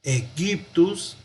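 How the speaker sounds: Egyptus said slowly with its Y sounded as a U; teacher demonstrating Egyptus with hard G and Y as U. Shown as a demonstration. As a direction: spell out Egyptus carefully, Y like U. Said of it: "Una opción es pronunciar".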